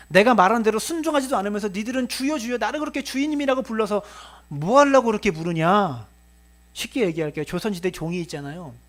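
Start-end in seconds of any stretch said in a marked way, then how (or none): 0.1-6.1 high, louder scolding building to a challenge; exasperated rebuke. Acted. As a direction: demand an answer from disobedient servants. Acting the Lord's rebuke. (답답한 듯 따져 묻기)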